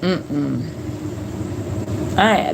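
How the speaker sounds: ordinary talk, nothing marked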